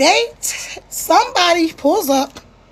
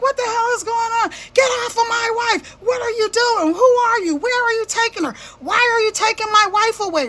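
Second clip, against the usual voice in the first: panicked husband; 7 semitones higher than usual. Retold or imitated. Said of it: "Your husband is like".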